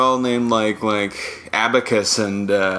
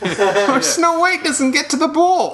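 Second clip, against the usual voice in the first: squeaky dwarf voice. Acted. Dwarves voiced in a comic bit.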